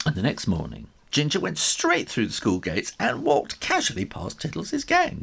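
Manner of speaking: plain reading voice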